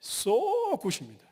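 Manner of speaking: high, drawn-out SO good; English version. Acted. Preacher giving the congregation the English version, drawn-out and high. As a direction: stretch "so" up high, then drop. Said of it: "영어로 하면요".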